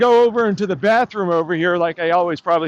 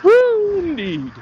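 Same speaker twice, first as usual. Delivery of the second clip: mock cheer; 10 semitones higher, slower than usual. Mocking cheer at crowd.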